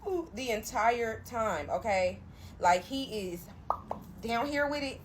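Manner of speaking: usual speaking voice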